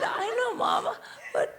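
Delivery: put-on high, soft child voice; mock-innocent little girl's protest; imitated little daughter. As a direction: plead small and high like a child. Voices daughter answering her mother.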